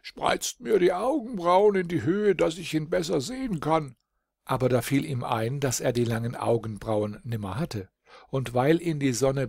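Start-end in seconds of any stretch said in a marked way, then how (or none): 0.0-3.9 high, commanding little king's voice; commanding dwarf king. A narrator's reading. Dwarf king about to command his servants, high and imperious. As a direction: order it like a pompous dwarf king. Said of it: "aus alter Gewohnheit befehlen".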